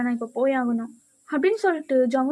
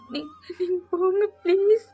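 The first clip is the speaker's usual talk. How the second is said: crying plea; 4 semitones higher than usual. Acted. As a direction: beg through acted tears, trailing to a weak whimper. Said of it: "நீ அழுகாத". (விம்மி அழுதபடி கெஞ்சல்)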